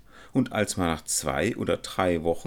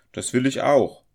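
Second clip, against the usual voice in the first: higher, louder eager chime-in; raised-voice exclamation; calling out; 9 semitones higher, 9 dB louder than usual. A narrator's reading. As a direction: call it out in quick agreement. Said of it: "riefen die drei übrigen".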